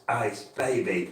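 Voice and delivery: normal talking voice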